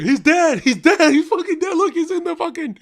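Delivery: high, loud, frantic yelling; hammed-up blurting at a funeral; re-enacted outburst. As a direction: shout it in escalating disbelief.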